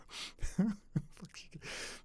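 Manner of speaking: airless, high giggle; quick unvoiced chuckle; breathy laugh. Real, not acted. Laughs after sarcastic retelling.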